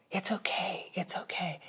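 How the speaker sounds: a gentle hushed "it's okay"; mock soothing whisper. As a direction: whisper it softly, like hushing a child. Soft comforting she didn't give.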